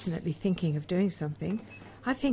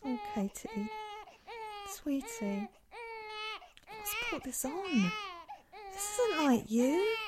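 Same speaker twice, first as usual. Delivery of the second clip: soft, higher, gently soothing; tender comfort; 11 semitones higher, 3 dB quieter than usual. Acted. Cher comforting tearful Katie, soft and tender. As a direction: coax her like a hurt child.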